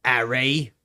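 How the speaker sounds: "Harry" said as Arry, dropping the H; silent H. Shown as a demonstration. Joking about names, "Harry" with a dropped H. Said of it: "The H was silent".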